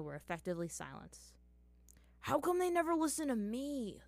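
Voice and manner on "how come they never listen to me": higher, louder put-upon whine; groaning complaint. Acted. Zuko complaining that nobody listens, groaning. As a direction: moan it like nobody ever listens. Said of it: "Zuko groaned".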